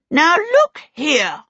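high, loud, slow kobold challenge; aggressive kobold. A narrator's reading. Small blue kobold confronting the visitors, aggressive. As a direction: confront them as an aggressive little creature. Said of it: "small and blue and aggressive".

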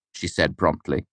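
ordinary delivery

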